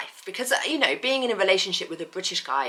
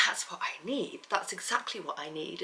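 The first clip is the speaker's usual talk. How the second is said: whisper; 9 dB quieter than usual. Real, not acted. Quiet aside to herself.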